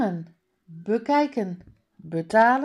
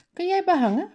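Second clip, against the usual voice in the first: high, louder astonished question; surprised; 11 semitones higher, 5 dB louder, much faster than usual. A narrator's reading. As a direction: ask it in surprise, pitch jumping up. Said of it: "vraagt Mala verbaasd". (verbaasde, hoge vraag)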